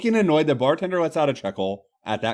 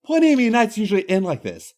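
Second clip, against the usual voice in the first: proud; 6 semitones higher than usual. Acted. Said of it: "rather proudly states".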